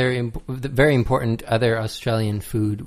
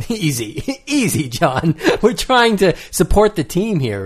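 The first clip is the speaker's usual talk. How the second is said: laughing through the words; chuckling protest; 7 semitones higher, 4 dB louder than usual. Real, not acted. Laughing while mock-scolding co-host.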